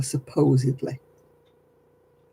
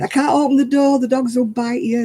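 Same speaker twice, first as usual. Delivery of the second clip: retold shout; 8 semitones higher than usual. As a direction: warn them over the barking dogs. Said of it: "And I'm shouting".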